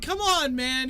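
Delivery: high, loud whiny call to a friend; whiny npc shout. Acted. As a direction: yell it like a sore loser. NPC complains about the game.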